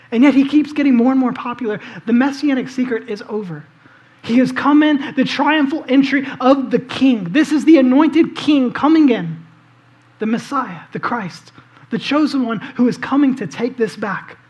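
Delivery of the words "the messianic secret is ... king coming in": ordinary talk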